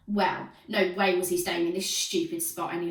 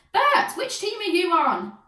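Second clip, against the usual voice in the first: high, loud, slow teacher call; loud, slow teacher's call; 11 semitones higher, 8 dB louder than usual. A narrator's reading. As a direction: call across the playground. Teacher calling across the pitch.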